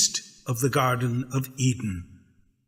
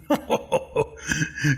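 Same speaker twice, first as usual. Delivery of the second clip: breathy mocking snicker; a sneaky little serpent laugh; sly snake chuckle; 2 semitones higher than usual.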